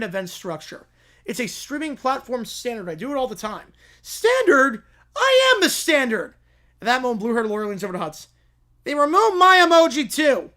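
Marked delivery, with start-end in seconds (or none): 4.0-6.3 high, loud indignant cry; outraged outburst. Acted. Plaintiff yelling at the defense in court, outraged. 8.8-10.5 loud, high shocked complaint; indignant yell. Acted. Blue-haired lawyer telling Hutz about his emoji, loud and shocked.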